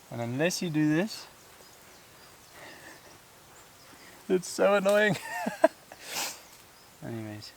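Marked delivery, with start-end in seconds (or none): 0.1-5.2 exasperated. Real, not acted. Swatting flies on a hike.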